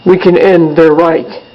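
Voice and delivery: measured, solemn declaration read aloud; formal speech. Acted. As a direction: deliver it like a pledge to the troops.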